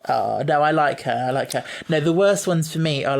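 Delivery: ordinary talk, nothing marked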